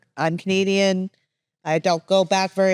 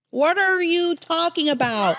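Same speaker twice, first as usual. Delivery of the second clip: high, slow, stretched-out question; exaggerated pun delivery; 9 semitones higher, slower than usual. Co-host reacting to the assault charge with a pun, high and drawn out. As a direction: draw out the words in a much higher voice.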